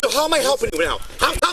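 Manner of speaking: loud, real scolding; forceful, heated confrontation; angry dressing-down. Real, not acted. Officer berates the detained man.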